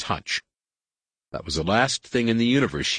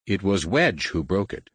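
ordinary delivery, nothing marked

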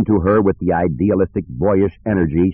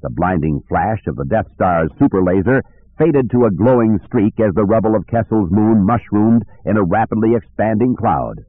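plain reading voice

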